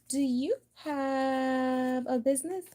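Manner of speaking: high, slow, drawn-out question; mock go fish asker. Playing go fish, asking the other player for a card, high and drawn out.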